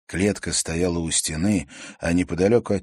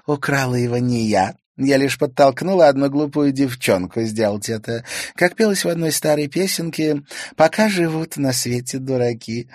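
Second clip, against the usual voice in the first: mocking girl; 7 semitones higher, 5 dB louder than usual. A narrator's reading. Sayana mocks.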